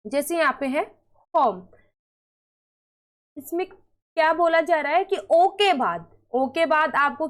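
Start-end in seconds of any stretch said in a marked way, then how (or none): 1.3-1.7 होम with a full round "oh"; long O. Shown as a demonstration. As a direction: say होम as "hohm", silent final e. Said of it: "ओ होता है".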